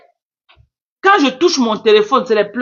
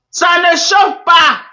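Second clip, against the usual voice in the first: slow, higher, louder repeat; emphatic mocking repeat; 7 semitones higher, 3 dB louder, slower than usual. Real, not acted. Streamer mocking a rival to viewers, slow emphatic. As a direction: hammer it home to the viewers.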